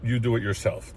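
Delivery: impatient boss taking over the job; bossy brush-off. Retold or imitated. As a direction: shoo them off, you'll do it yourself. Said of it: "I was like".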